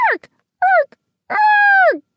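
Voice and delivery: shrill, loud mechanical cackle; robot laugh. A narrator's reading. Robot head keeps cackling at the boy, shrill and loud. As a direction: screech it like a broken robot laugh. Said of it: "the laughter didn't stop".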